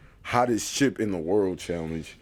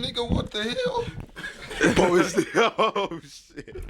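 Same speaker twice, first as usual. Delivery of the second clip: laughing surprise; 10 semitones higher, slower than usual. Real, not acted. Sees friend's nose sweating.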